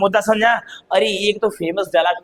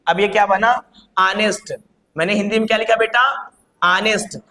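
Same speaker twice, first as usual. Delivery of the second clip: ordinary talk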